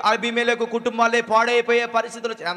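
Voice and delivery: ordinary talk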